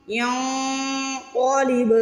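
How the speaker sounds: ینقلب with the ن hidden in the nose; ikhfa demo. Shown as a demonstration. Teacher showing ikhfa on ینقلب, slow nasal chant. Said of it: "اس کو ناک میں چھپانا ہے".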